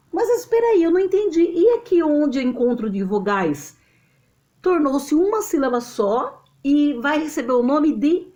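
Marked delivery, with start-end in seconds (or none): 0.1-3.8 higher-pitched puzzled interruption; acted student objection, starting high; confused student. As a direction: pipe up as a pupil who is lost. Voices a confused student's question.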